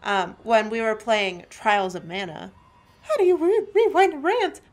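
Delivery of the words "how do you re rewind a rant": high joking voice. Real, not acted. Joking aside.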